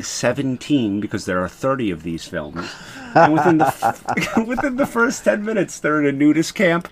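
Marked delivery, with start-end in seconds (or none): 3.2-6.9 breaking into laughter mid-sentence, higher; laughing. Real, not acted. Telling the host about the nudist camp film, laughing. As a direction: laugh as the story gets absurd.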